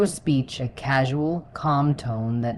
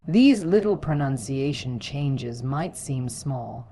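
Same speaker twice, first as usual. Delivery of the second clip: usual speaking voice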